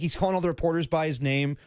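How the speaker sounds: ordinary talk, nothing marked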